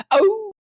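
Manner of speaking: high, drawn-out dog whine; high-pitched mock howl; imitated dog woo. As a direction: woo like an attention-seeking dog. Imitates excited dog demanding attention.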